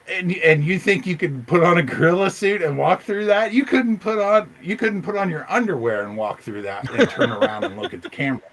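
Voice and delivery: loud, high-pitched indignation; incredulous rant. Real, not acted. Bigfoot believer arguing with hoax claimants, incredulous rant. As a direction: challenge the doubters, voice climbing high.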